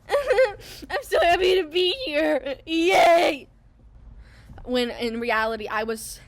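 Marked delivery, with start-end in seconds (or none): 0.1-3.5 mock overjoyed, high, slow and loud, with a sobbing edge; fake cheerful. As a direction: play the fake-happy act, near tears. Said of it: "And I was just like".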